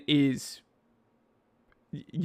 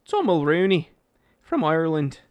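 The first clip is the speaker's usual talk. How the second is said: put-on Irish accent; 4 dB louder than usual. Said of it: "we do the accent".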